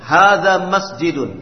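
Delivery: loud, clear, deliberate Arabic vocabulary reading; model reading drill. Shown as a demonstration. Teacher modelling Arabic words for students, loud and clear. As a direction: read each phrase out crisply for the class to copy. Teacher leads class repetition.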